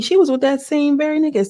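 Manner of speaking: high-pitched mock accusation; retold dragging. Retelling her attack on another woman, mock scornful accusation. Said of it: "she started dragging her".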